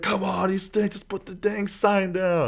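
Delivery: high, slow jeering voice; mock heckler. Fans heckling the Ohio State girl, high jeering. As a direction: heckle her like a rival fan. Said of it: "They're like".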